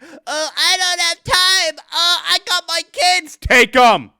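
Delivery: high, loud mocking whine; mock a lazy customer's excuses; whiny customer. Mocking a customer's excuses, high whiny voice.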